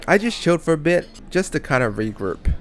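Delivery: ordinary delivery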